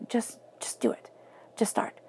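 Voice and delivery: mom's urging, whispered with no voice. Retold or imitated. Mom whispering encouragement to her hesitant daughter. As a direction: breathe it like a gentle push.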